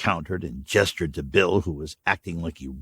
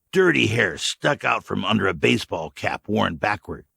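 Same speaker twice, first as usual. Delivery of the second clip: ordinary delivery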